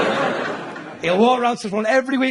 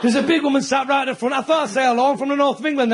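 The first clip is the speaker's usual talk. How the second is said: ordinary talk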